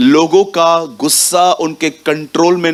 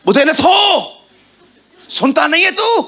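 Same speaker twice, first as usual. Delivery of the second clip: mock yell; 11 semitones higher than usual. Preacher mimics a mother screaming at her son. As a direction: bellow like an exasperated mother.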